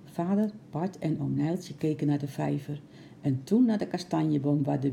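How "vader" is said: ordinary delivery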